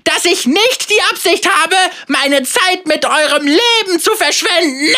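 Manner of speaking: hoher, lauter Schurkenspott; mustache twirling villain. Acted. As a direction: diabolisch triumphieren. Villain gloats. (triumphierender Bösewicht)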